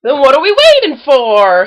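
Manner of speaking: loud eager hoot. A narrator's reading. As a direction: shout it out raring to go. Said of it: "hooted Tigger".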